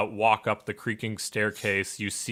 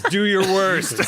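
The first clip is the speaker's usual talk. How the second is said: defiant taunt; 8 semitones higher, 7 dB louder, slower than usual. Acted. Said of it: "he says".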